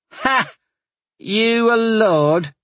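high, mocking laugh; laughing scoff. A narrator's reading. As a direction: laugh him off as a lord. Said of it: "Blogel laughed".

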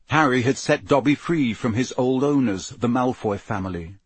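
plain reading voice

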